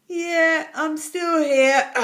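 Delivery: slow, grumpy dragon reply; a sulky dragon, irritated; cross dragon. A narrator's reading. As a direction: drag it out, put out and cross. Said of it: "replied the dragon crossly".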